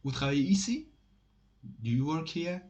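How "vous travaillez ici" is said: "Vous travaillez ici?" rising at the end into a question; questioning intonation. Shown as a demonstration. Teacher demonstrating asking without est-ce que, questioning intonation. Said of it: "normal way of questioning avec intonation".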